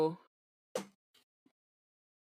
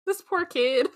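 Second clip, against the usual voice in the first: pitying; 10 semitones higher, 6 dB louder than usual. Retold or imitated. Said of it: "the moment where I was just like".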